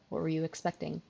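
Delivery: ordinary delivery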